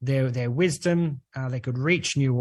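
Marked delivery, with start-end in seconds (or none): none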